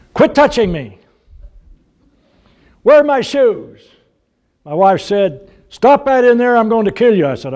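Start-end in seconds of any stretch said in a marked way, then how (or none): 0.1-3.8 put-on high-pitched whine of a bickering child; squabbling children. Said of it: "we heard something like this". 5.7-7.3 high-pitched exasperated mother, imitated; mock scolding. Wife scolding the squabbling children.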